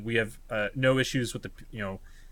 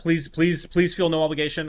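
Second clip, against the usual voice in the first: urgent, louder and higher plea; earnest plea; 6 semitones higher, 5 dB louder than usual. Real, not acted. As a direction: beg them warmly not to feel pressured. Urging listeners, no pressure.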